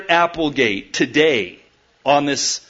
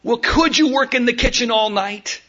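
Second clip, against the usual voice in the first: put-on higher, childlike pleading voice; pleading little girl; 8 semitones higher than usual. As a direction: beg like a small girl who doesn't want to be alone. Daughter pleads with mom.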